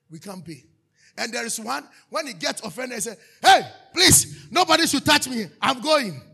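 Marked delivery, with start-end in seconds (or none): 3.4-6.2 loud high shout, storming off; imitated angry shout. Offended church member storming away, loud mock shout. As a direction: yell like an offended member leaving.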